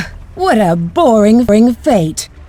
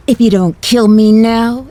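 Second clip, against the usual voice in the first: usual speaking voice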